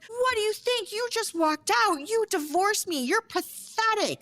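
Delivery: high-pitched acted tirade; irate. Wife lashing out at her ex in public, irate. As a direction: tear into them, voice way up. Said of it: "just irate".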